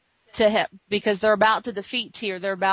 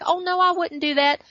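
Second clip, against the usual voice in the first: high-pitched mock denial; imitated villain playing innocent; feigned innocence; 8 semitones higher than usual. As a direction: deny it far too innocently.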